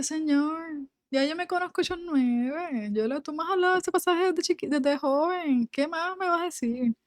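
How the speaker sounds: queja cansada, mucho más aguda; cansada y quejosa. Retold or imitated. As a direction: reclamo soñoliento de quien no quiere levantarse. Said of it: "el cansancio estaba hablando". (ella, cansada, le reprocha a Dios, aguda y quejosa)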